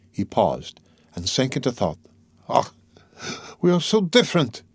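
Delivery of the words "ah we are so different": high, exclaimed lament; anguished cry. A narrator's reading. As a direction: cry out at the gulf between you. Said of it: "he cried again".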